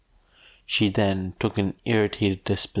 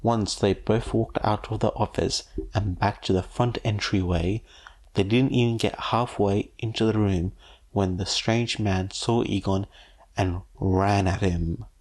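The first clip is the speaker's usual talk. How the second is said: ordinary delivery, nothing marked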